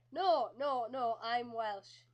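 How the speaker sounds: loud, insistent no, no, no; emphatic denial. Retold or imitated. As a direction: firmly set him straight that you're Welsh. Retold correcting a French vendor.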